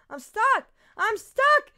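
rising from an urgent cry to a full high scream; panicked screaming. Real, not acted. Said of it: "He screamed louder than me".

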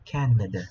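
Canada with a light "ờ" ending; English Canada. Shown as a demonstration. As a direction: end Canada on an unstressed schwa. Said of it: "chúng ta đọc nhẹ thành ờ thôi".

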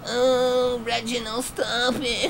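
very high, whiny falsetto; mock whimper. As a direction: squeak it out like a whining kid. Mock victim voice.

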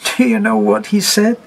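half-laughing, half-gasping disbelief; gasped out. Acted. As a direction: hysterical gasp. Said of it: "she gasped".

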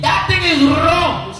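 shouted high and loud; preacher's shout. Real, not acted. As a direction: thunder it from the pulpit. Preacher condemning wrongdoing.

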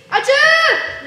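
calling out. Acted. Calling the guard.

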